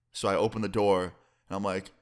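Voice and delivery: normal talking voice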